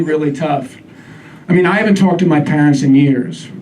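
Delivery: ordinary delivery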